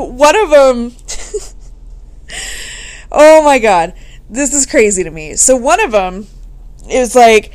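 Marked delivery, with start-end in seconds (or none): none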